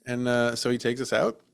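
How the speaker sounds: normal talking voice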